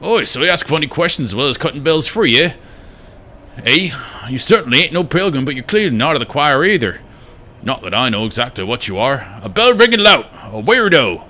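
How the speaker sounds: jab the finger at the stranger; accusing grump character. Acted. NPC dialogue read in voice.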